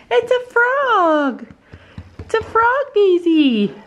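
high, slow laughing pet talk; high laughing reassurance. Real, not acted. Owner laughingly telling Daisy it's just a frog. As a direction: laugh and soothe the dog.

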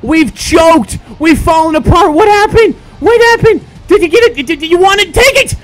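loud, high, disbelieving rage; real meltdown, peaking in a high scream; furious shouting. Real, not acted. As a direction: yell at the player who blew it. Raging after losing.

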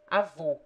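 "avô" with a closed final o; closed, stressed o closing "avô"; close o. Shown as a demonstration. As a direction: end "avô" on a rounded, tight "oh". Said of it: "open and close sounds".

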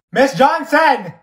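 loud, drawn-out call of Miss Johnson!; yelling the teacher's name. Acted. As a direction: shout Miss Johnson! slowly across the classroom. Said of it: "I have to say your name three times".

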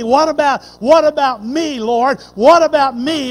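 loud, high fearful pleading; a disciple crying out in fear; anxious plea. Retold or imitated. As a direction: beg to know if it is you. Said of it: "asked him and said".

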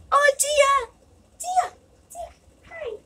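high cooing at the cat; pet voice. Real, not acted. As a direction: coo the cat's name up high.

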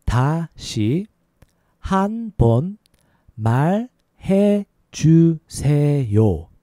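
syllable by syllable, with gaps; slow demonstration. Shown as a demonstration. Teacher showing learners how to ask for a repeat, slow. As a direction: draw out each syllable for learners. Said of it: "A little faster".

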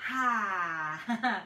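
forceful open-mouthed exhale, tongue out; lion's breath exhale. Shown as a demonstration. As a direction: push the breath out with a ha. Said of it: "And we'll take a lion's breath".

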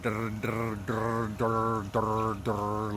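drr with the tongue fluttering behind the teeth; rolled drr exercise; trill drill. Shown as a demonstration. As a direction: buzz drr with voice and air. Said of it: "like that".

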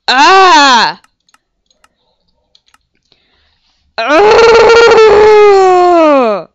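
long, loud high wail; anguished wail. Real, not acted. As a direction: howl out the loss, real anguish. Frustration after death.

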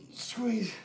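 breathless whispered squeeze; whispered cue. Real, not acted. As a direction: whisper the cue mid-effort. Coach cueing during hard set.

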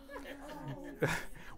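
high, soft incredulous laugh; a short burst of disbelieving laughter. Real, not acted. Laughing at a warning label on a flour bag, high laugh.

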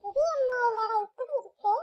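high whimpering alarm; child startled by the spooky house, whimpering; frightened whimper. Acted. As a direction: recoil with a scared whine.

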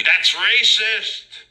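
high, loud, slow squeal of outrage; mock indignant sergeant. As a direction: ham up the sergeant's fake offense.

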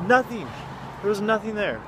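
loud, high exasperated disbelief. Real, not acted. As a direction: throw up your hands, nothing there. Exasperated discovery, nothing there.

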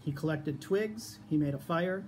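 plain reading voice

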